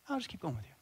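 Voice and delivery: very soft, understated repeat; quiet insistence. Acted. Pack carrier repeating the offer to a baffled soldier, softly insistent. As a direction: say it small and quiet, not budging.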